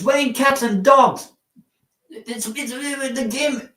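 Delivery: high, far-off mock British "Ruining it" with the t dropped; "Ruining it" sung up high like a Brit; mock British accent. Shown as a demonstration. Streamer mocking British slang, high mock British accent. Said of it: "What a British slang".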